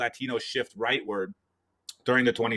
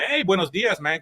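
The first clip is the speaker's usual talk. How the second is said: high-pitched, breezy hello; acted-out upbeat greeting; casual friendly greeting; 8 semitones higher, 3 dB louder than usual. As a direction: hail a store worker like a buddy. Acts out greeting a store worker.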